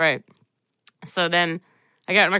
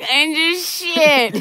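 distressed; 10 semitones higher, 4 dB louder, much slower than usual. Retold or imitated. Retelling her distressed confession.

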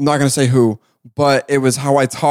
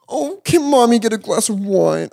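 high, slow, drawn-out request; put-on sultry mommy voice; flirty mommy; 8 semitones higher than usual. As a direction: ask it teasingly, stretched and high. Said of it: "that actually sounds kinda hot".

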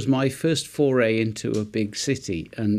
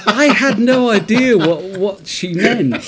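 laughing; 9 semitones higher, 7 dB louder than usual. Real, not acted. Laughing at own naivety.

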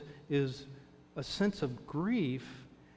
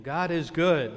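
calling out the greeting, louder; call to congregation; 2 semitones higher, 7 dB louder than usual. Retold or imitated. Pastor calling out the greeting to the congregation, loud. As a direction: ring it out to the room like a welcome.

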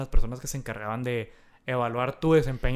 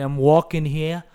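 put-on slow, drawn-out New York accent; 3 dB louder, much slower than usual. Host showing the Nueva York accent, slow New York drawl. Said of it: "el acento de Nueva York".

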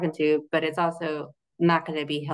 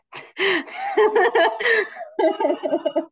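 real laugh, high and loud; high burst of laughter; 5 dB louder than usual. Real, not acted. Guest laughing at her own surprise, high laughter. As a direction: laugh out in amazement.

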